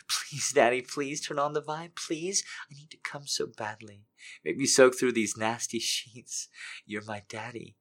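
soft, breathy, pleading whisper-voice; soft desperate begging. Acted. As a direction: plead softly, hungry and desperate. Character keeps begging in whispers.

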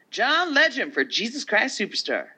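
high, loud awards-host announcement; announcer voice. Pretend. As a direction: announce it like a big reveal. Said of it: "I'm trying to sound like the announcer chick".

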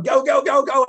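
high, loud yell to move. Retold or imitated. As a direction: shove everyone along, yelling. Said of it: "started yelling".

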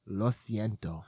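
low, put-on Spanish "lo siento"; Spanish accent. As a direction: say it like a Spanish speaker. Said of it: "Ooh, international".

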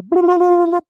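high, loud mocking blah-blah; mock babble. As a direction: parody a confused man hearing only noise. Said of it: "that was the sound he made".

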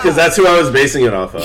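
laughing. Real, not acted. Laughing about her drawing.

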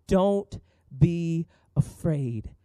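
very slow, tender, fading to a hush; gentle Jesus voice. Acted. Jesus reassuring the grieving father, slow and tender. Jesus repeats his words.

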